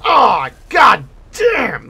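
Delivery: long drawn-out furious yell; pained angry shout. Real, not acted. Gamer hit by the boss, drawn-out angry shout. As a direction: cry out as the hit lands.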